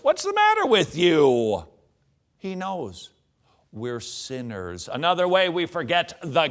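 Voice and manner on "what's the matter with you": mock exasperated outburst. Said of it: "God never goes".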